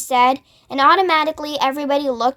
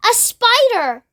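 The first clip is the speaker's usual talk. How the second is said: high startled cry of disgust; cried out; 9 semitones higher than usual. A narrator's reading. Aunt Sponge spots a spider, cries out. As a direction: shriek at the spider overhead. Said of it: "she cried".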